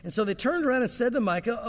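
normal talking voice

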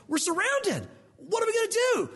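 servant's voice jumping high in alarm; panicked. Retold or imitated. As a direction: blurt it out in a frightened servant's alarm. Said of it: "he freaks out".

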